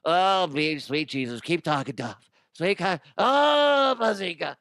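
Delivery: gushing nerd voice, peaking high on Oh; mock excited nerd. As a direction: imitate a dinosaur nerd swooning. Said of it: "All the nerds listening to this are like".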